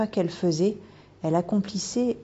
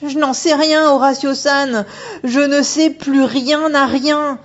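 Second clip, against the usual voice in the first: put-on high, loud frightened girl's voice rising to a cry; a schoolgirl losing her nerve, ending in a yelp for help; panicked young girl; 9 semitones higher, 9 dB louder than usual. Megumi panicking at the otter woman, high frightened girl voice.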